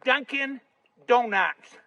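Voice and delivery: each word set apart with a pause; real slow. Retold or imitated. Local fella naming the place for the wife, real slow. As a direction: spell it out slowly for a slow listener. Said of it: "say it real slow".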